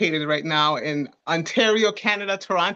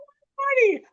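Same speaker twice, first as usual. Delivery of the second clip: surprised. Real, not acted. Laughing correction of her age.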